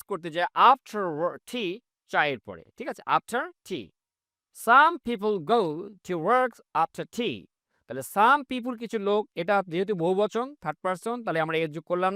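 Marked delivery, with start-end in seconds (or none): 4.6-7.5 very slow, word by word; slow model reading. Shown as a demonstration. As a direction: read the English sentence slowly, each word apart. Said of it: "slow model sentence".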